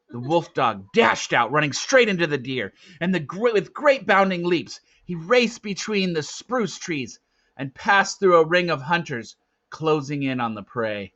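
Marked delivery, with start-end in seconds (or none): none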